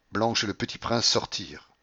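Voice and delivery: usual speaking voice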